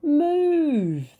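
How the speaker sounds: high, loud command; shout. Acted. Knights shouting at Boy to move. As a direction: bark it out to clear the way. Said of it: "they shouted".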